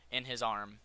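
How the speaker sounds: normal talking voice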